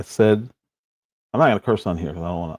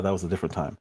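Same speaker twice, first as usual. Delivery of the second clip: usual speaking voice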